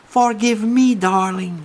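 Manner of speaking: high, loud English plea; babbling English begging to the crying girl; stammering English. Retold or imitated. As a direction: blurt it out in English, pleading. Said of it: "Je bafouille en anglais".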